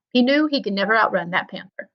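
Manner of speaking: plain reading voice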